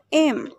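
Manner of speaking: "im" with no h; weak form of 'him'. Shown as a demonstration. Teacher showing learners the weak form of him, "im" without h. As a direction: drop the h, just "im". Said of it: "The weak form of him is".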